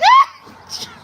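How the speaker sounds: very high, loud squeal of laughter; shrieking laughter. Real, not acted. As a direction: let out a sudden piercing laugh. Bursts out at silly joke.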